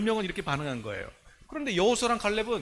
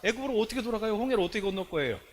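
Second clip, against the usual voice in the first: normal talking voice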